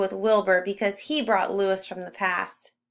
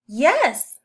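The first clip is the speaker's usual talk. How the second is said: a louder, higher happy yes; eager yes; 4 semitones higher, 5 dB louder than usual. A narrator's reading. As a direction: blurt it out gladly. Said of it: "Louis says".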